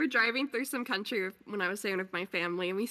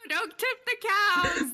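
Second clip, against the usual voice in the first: higher, louder, slower, through laughter; laughing plea; 9 semitones higher, 5 dB louder, slower than usual. Real, not acted. Friend jokes about a cow raid, laughing plea. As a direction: beg them laughing not to do it.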